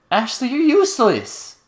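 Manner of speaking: high, drawn-out exasperated complaint; playfully exasperated, high and stretched out; mock scolding. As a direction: scold the companion in a slow, high-pitched wail. Scolding game companion.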